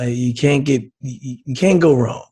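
ordinary talk, nothing marked

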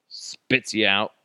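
usual speaking voice

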